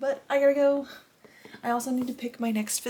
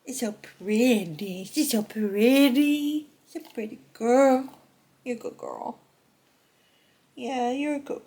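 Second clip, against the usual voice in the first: cooing at the dog; 3 semitones higher, 5 dB louder than usual. Real, not acted.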